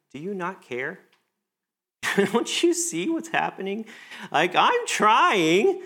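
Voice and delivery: rising to high, loud and very slow on the last words, with a laugh; a frustrated plea building to desperation; exasperated complaint. Acted. Praying to God about feeling unseen, building exasperation. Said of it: "Have we ever asked that of God?".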